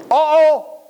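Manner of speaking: high, playful uh-oh; teasing mock surprise; mock alarm. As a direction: pretend you just got caught out.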